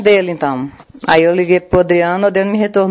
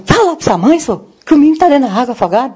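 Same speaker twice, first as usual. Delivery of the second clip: higher, quicker re-enacted alert; retold urgent message; 7 semitones higher than usual. Someone tells a man to inform the mother, retold urgent message. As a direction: relay it urgently in a raised pitch.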